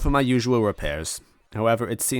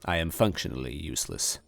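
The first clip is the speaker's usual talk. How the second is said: usual speaking voice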